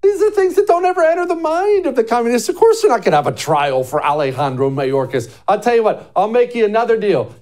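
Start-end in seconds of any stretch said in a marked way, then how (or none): none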